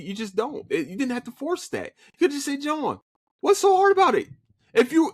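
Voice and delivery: ordinary talk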